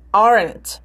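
"Aren't" hit on the first syllable, falling away; front-stressed "Aren't" with falling end; strong start. Shown as a demonstration. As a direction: punch the "Are" of "Aren't". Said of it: "Potência no início".

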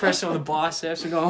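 usual speaking voice

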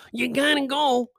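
high put-on voice of a pushy friend; imitating Elliot. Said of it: "I don't sound like that dude".

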